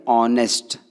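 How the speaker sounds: ऑनेस्ट said with no h sound, starting on the vowel; silent h. Shown as a demonstration. Teacher showing students ऑनेस्ट is said without h. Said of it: "वो हॉनेस्ट ना होते हुए क्या है".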